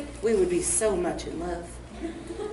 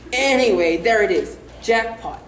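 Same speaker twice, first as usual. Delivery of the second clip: normal talking voice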